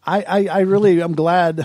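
words carried on a real laugh; laughing as he says he's glad. Real, not acted. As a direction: chuckle through the start of the line. Laughing while answering guest.

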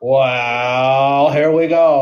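put-on American accent. Presenting fake facts to Sam, hammed-up American accent. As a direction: read the facts like a cheesy American host. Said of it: "we're doing accents again".

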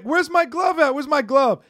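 high, insistent mock demand; imitated demand. Retelling the man demanding his glove, high mock demand. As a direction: ask like the rattled heckler. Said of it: "he's like".

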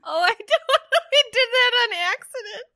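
high, loud laughing words. Real, not acted. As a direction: talk through a laugh. Laughing at mic punching.

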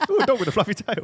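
high squeaky voice through laughter; laughing silly voice. Pretend. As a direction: coo it while cracking up. Continuing silly voice.

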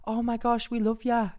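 high-pitched mock Newcastle accent; attempted Newcastle accent. Jade's friends from home greeting her, attempted Newcastle accent. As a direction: have a go at Newcastle, pitched right up. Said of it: "that's my attempt at a Newcastle accent".

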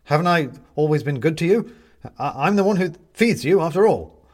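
high, loud, stammering plea; frightened pleading. A narrator's reading. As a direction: beg the cat, voice up high. Benedict pleads with the cat.

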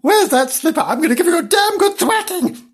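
high, hammed-up scolding; mock threat. Mistress threatens animals.

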